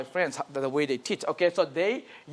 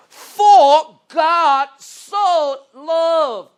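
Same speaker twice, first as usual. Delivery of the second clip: very slow, louder and higher, each word set apart; slow and clear; 9 semitones higher, 9 dB louder, much slower than usual. Shown as a demonstration. Teacher showing students the key verse, slow and clear. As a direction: drag out "For God so loved" word by word. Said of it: "slow and clear".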